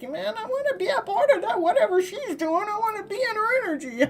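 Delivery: high, laughing mock voice of a smitten man; eager man. Reader voicing the divine masculine longing to share her energy, playful and high. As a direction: squeal it out like a guy who can't wait to join in. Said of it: "It's like".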